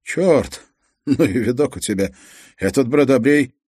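high, loud burst of shock; startled exclamation. A narrator's reading. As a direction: blurt it out, taken aback. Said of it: "глаза полезли на лоб".

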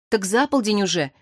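ordinary delivery

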